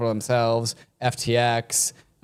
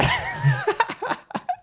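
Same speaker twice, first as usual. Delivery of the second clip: laugh. Real, not acted. Cracks up after impression.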